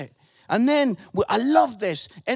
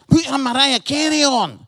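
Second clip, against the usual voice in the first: loud, broad Scottish request to Siri; Scottish accent; 8 dB louder than usual. Shown as a demonstration. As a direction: bark it at the phone in broad Scottish. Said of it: "being Scottish".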